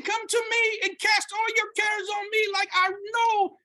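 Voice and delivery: high, loud pleading sermon call; preaching at full force. Acted. Preacher voicing the Lord's invitation, loud and high. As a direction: call out to the whole room with conviction.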